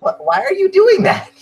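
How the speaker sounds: very high squeal of protest; high-pitched, bewildered objection; alarmed protest. Acted. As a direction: squeak it out in dismay. Player protests at mother's staring.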